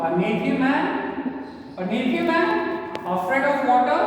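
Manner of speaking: high, rising disbelief; astonished, lifted demo reading; exclamatory reading. Shown as a demonstration. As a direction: exclaim it in surprise, pitch climbing. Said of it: "So what was the sentence? Exclamatory sentence".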